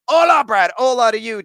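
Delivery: high, loud cheerful hello; excited greeting. Real, not acted. Greets viewers loudly.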